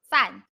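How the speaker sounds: "Fan" loud with a falling fourth tone; fourth tone demo. Shown as a demonstration. Teacher stresses an important Pinyin example, loud fall.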